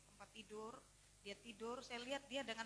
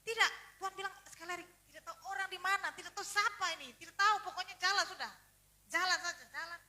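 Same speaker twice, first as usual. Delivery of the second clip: loud, high, pressing command; insistent urging; 7 semitones higher, 14 dB louder than usual. Retold or imitated. Doctor urging team onward because God said so, loud and insistent. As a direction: override every doubt and drive them on.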